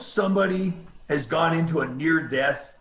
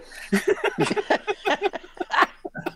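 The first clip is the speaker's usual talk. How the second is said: high laugh. Real, not acted. Laughing after telling the story.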